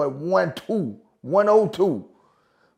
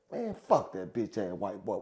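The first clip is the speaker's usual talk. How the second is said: plain reading voice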